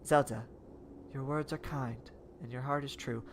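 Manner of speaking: soft robot voice; a calm robot reply. Acted. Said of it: "doing, like, a robot voice for her".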